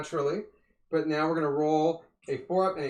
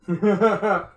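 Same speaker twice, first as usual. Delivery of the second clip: loud laugh; 4 semitones higher, 10 dB louder than usual. Real, not acted. Laughing at huggable wizard.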